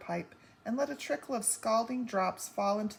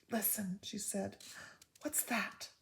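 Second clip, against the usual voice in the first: much softer, tense listening; hushed alarm; 7 dB quieter than usual. A narrator's reading. Mother hushing her daughter to listen, soft and tense. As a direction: hush and listen. Homily hears a sound.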